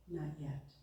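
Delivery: low, hushed, patient reply; quiet refusal. A narrator's reading. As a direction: drop right down, soft and low. Master mouths refusal through oven door.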